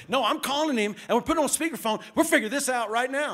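loud, high insistence; angry insistence. Retold or imitated. Pastor insisting to the student he is calling his dad, loud. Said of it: "I was going off".

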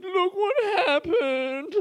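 high, slow, laughing half-cry; babyish whimper. Retold or imitated. Showing housemate the ruined card, slow babyish whimper. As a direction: whine like a sulking baby. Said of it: "'Cause I'm such a fucking baby".

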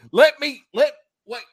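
high and loud, stammering; surprised. Retold or imitated. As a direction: stop short in disbelief. Stunned retelling.

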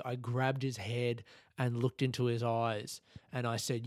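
normal talking voice

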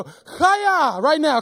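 "Chaya!" yelled high and loud like a martial arts cry; preacher leading the church in a karate cry; karate chop shout. Shown as a demonstration. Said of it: "Just go".

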